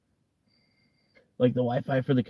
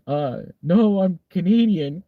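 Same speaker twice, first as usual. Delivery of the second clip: higher, louder on the claim, drawn out; boy's hesitant denial; 6 semitones higher, 5 dB louder, slower than usual. Retold or imitated. Boy fibbing to a French police officer, drawn-out mock denial. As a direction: stretch out an unconvincing mock fib. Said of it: "he says".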